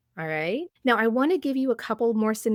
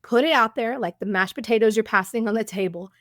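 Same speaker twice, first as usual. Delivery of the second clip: normal talking voice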